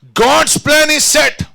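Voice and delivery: very slow, high proclamation; high, weighty pulpit declaration; full-force preaching. Acted. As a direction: proclaim it word by word, drawn out.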